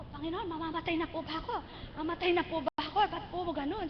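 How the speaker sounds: a frightened plea to the Lord; mild fearful plea. Retold or imitated. Asking the Lord if she is dying, softly afraid.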